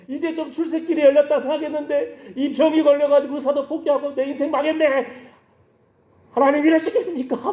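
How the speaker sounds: high-pitched, hammed-up wailing lament; tearful crying out. Preacher imitating sick official alone before God, tearful crying out. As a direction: wail at God in mock-tearful complaint. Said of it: "혼자 막 울면서 부르짖고 막 원망하고".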